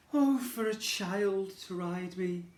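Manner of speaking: high, slow pleading horse; homesick horse. Acted. Circus horse longing for home, high and slow. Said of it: "he said".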